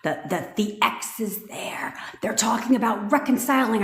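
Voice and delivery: breathless, unvoiced panicked whisper. Mocking her viewers who would panic, in a frantic whisper. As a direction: whisper it like a spiraling, jealous girlfriend. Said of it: "Most of you would have panicked".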